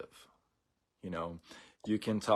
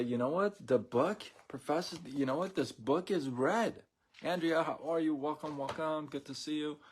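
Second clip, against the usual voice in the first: higher, lightly voiced fed-up class; mock exasperated students; 7 semitones higher than usual. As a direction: pitch it up like irritated students. Exasperated students answer the professor.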